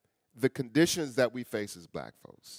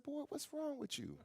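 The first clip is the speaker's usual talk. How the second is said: a much higher, softer mother's voice; 13 dB quieter than usual. Retold or imitated. Mother asks her teary son what's wrong, high and soft. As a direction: lightly voice mama, high and soft.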